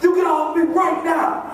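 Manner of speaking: high mock shout of a kid; mock demand. Boy ordering the staffer off him, high mock shout. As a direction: order him off with fake force. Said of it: "So I'm all like".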